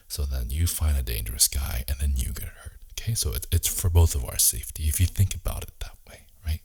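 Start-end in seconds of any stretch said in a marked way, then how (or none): none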